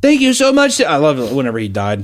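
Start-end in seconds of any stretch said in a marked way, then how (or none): 0.0-0.8 gushing high-pitched thanks. Retold or imitated. Mock tribute.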